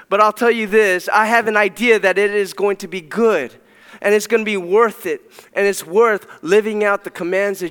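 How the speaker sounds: loud, high preaching voice; preaching at full force. Real, not acted. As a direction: declare it with conviction.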